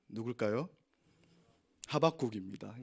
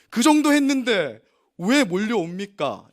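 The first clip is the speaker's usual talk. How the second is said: indignant complaint; 4 semitones higher, 8 dB louder, slower than usual. Retold or imitated. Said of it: "이렇게 대답하는".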